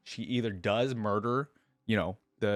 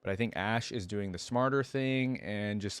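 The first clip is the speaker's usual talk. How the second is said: plain reading voice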